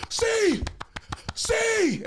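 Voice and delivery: high-pitched hollered call, spaced out; noisy calling. Retold or imitated. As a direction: holler a friend's name to wake him. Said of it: "making so much goddamn noise".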